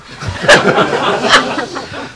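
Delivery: high breathless burst of laughter; real laugh. Real, not acted. Storyteller laughing at his own tale, high breathless laugh. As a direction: crack up at the beer detail.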